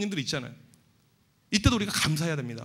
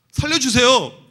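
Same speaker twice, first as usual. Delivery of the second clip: desperate plea; 5 semitones higher, 8 dB louder, slower than usual. Acted. Acted desperate prayer in fire.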